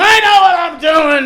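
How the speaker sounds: high, loud stubborn yell; loud mock-captain outburst; acted defiant shout. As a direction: shout back, brushing off the warnings. Acted captain yells back at audience.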